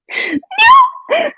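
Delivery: high, loud cry-laugh; shrieking laugh. Real, not acted. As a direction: break into helpless laughter. Cracking up at own joke.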